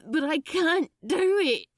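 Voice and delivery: high, loud, half-laughing terror; frightened yet triumphant outburst; terrified and triumphant. Acted. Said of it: "sounded terrified and triumphant".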